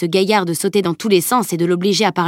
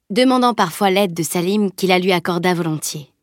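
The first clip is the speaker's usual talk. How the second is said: usual speaking voice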